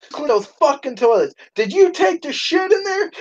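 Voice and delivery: high-pitched, indignant mock scolding; imitated janitor. Janitor walking in on the man, high mock scolding.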